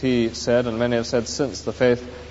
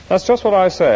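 higher, louder indignant outburst; indignant ghost; 8 semitones higher, 7 dB louder than usual. Acted. The ghost protests to the spirit, indignant and loud. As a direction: snap back in protest.

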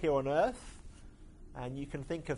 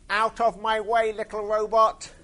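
loud, high, booming war-machine voice; growling tank; 8 semitones higher, 11 dB louder than usual. Acted. Battle tank growling at little robot Marvin, loud menacing bellow. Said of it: "growled the tank".

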